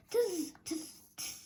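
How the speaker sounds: whispered tss tss of mocking monkeys; teasing whispered tsk-tsk. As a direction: hiss it like cheeky monkeys. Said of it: "and said".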